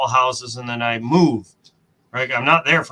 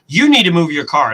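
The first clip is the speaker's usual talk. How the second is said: louder, curt mock of a rude neighbor; snarky demand; 4 semitones higher, 6 dB louder than usual. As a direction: snap it back at him, mean and impatient. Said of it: "so snarky at me, like, so mean".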